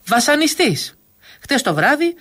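high-pitched dramatic accusation; animated teasing, pitched well up; vivid storytelling. A narrator's reading. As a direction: launch theatrically into a tall tale. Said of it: "ο Μούτσης συνέχισε παραστατικά".

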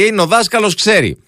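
a light mock parent; old-school parent. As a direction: voice a parent who defers to the teacher. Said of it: "Έλεγε".

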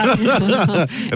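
high-pitched burst of laughter. Real, not acted. Host laughing at the co-host's disbelief over house prices, high laugh.

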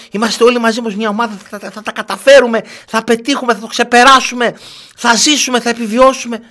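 high, hammed-up pep talk; mock rallying speech. Host mocks mother's team pep talk, high hammed-up voice.

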